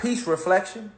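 soft, hushed, unbothered; calm mantra. Retold or imitated. Shrugging off gossip with a hushed calm mantra. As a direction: murmur it like a calm mantra to yourself. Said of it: "that's why I be like".

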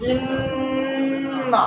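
ইন্না drawn out very slowly on a steady nasal n; ghunnah on ইন্না. Shown as a demonstration. Teacher models recitation for pupils, ইন্না with long ghunnah. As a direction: hold a long humming n in ইন্না. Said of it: "ভালো করে গুণনা করতে হয়".

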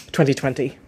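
ordinary talk

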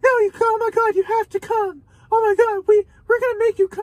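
squealing falsetto, fast and breathless; mock the pushy friends in a shrieking high voice; over-the-top girly squeal begging; mock gushing friends. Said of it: "They were like".

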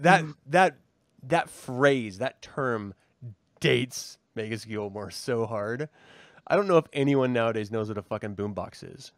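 ordinary talk, nothing marked

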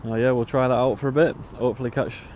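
ordinary talk

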